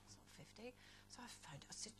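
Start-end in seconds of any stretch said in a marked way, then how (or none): 0.1-0.7 whispered thought. Retold or imitated. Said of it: "I thought".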